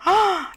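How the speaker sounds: high, loud "ah"; short high-pitched yelp; mock-alarmed cry. Real, not acted. Réagit au personnage en colère. (petit cri aigu et fort)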